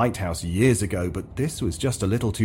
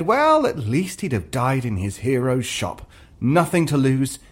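usual speaking voice

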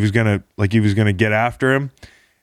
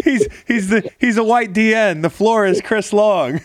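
high-pitched, cracking up while talking; laughter pushing the voice up high; amused laughing; 11 semitones higher than usual. Real, not acted. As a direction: repeat a joke through laughter. Cracks up at guest's joke.

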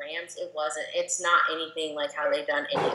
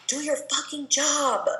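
higher, slow mock bark at teammates; imitating an angry order; 10 semitones higher than usual. Mimicking the defensive captain scolding teammates, higher, slow mock anger. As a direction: play the pissed-off defensive leader, pitch up and stretch it. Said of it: "gets so pissed off or whatever".

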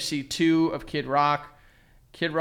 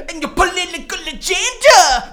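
mock rock singer; 13 semitones higher, 7 dB louder than usual. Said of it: "He's like".

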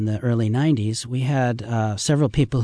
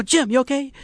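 mock worried call; 13 semitones higher than usual. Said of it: "And you go".

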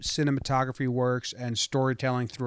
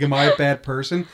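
laughing quote; 2 semitones higher, 9 dB louder than usual. Retold or imitated. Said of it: "She's like".

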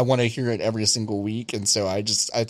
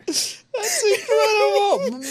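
laughing; much slower than usual. Real, not acted. Cracking up at the story.

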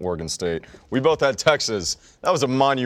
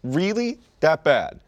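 incredulous; 7 semitones higher, slower than usual. Retold or imitated. Incredulous mock reaction.

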